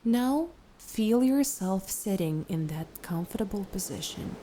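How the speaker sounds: unhurried, soothing instructions, easing off at phrase ends; guided meditation. Acted. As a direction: gently lead the listener into relaxation, letting each phrase settle.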